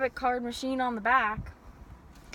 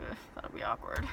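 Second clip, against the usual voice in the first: muttered aside; 9 dB quieter than usual. Real, not acted. Embarrassed aside about cameras.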